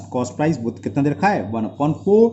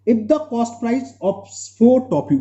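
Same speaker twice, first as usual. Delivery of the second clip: slow dictation; 6 semitones higher, much slower than usual. Real, not acted. Teacher dictates question slowly.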